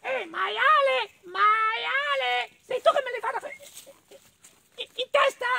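very high squeaky mole, drawn-out calls; little mole. Acted. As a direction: squeal the accusation, stuttering at the end. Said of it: "E non era stato neanche il cavallo".

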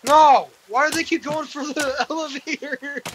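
loud no, then laughing slowly; laughing in disbelief; exasperated shout. Real, not acted. Mobs heading for the elevator.

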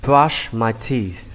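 slow "brush my teeth" with a long SH in "brush"; deliberate model "brush my teeth", hushing SH; clear sh ending. Shown as a demonstration. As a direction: hold the sh of "brush" before "my teeth". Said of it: "Đọc chữ S H âm sh".

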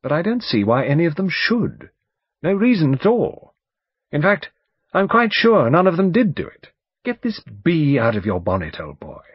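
high, emphatic barrister voice; blustering lawyer, insistent. Acted. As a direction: insist firmly, brushing the idea aside.

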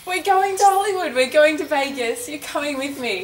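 high and loud, bursting with news; loud thrilled announcement; excited announcement. Real, not acted. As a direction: blurt the big surprise.

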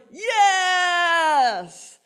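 loud, high roared yes; roaring crowd. Retold or imitated. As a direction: roar it like thousands. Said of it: "they roared".

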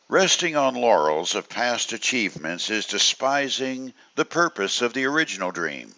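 ordinary talk, nothing marked

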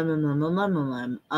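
ordinary delivery

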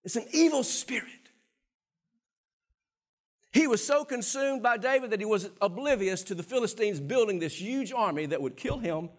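ordinary talk, nothing marked

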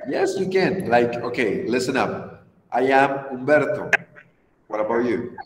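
ordinary talk

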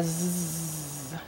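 long low buzzing "zzz" for z; sustained phonics z; letter sound. Shown as a demonstration. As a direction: hold a soft z buzz. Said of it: "I wanna hear you say it".

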